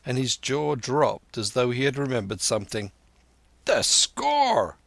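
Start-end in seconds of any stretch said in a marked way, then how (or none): 3.6-4.8 sudden high cry; burst out. A narrator's reading. Silver suddenly remembers the unpaid score, surprised high cry. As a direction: blurt it as it hits you. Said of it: "he burst out".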